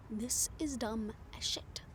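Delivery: slow annoyed whisper to herself; whispered grumble. Acted. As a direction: grumble under breath, alone and fed up. Alone on rooftop muttering.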